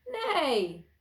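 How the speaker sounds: high, loud imitated horse neigh; mighty neigh. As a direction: neigh with all your might. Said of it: "with all her might".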